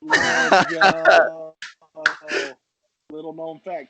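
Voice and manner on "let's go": excited. Real, not acted. Cheering the auction sign-off.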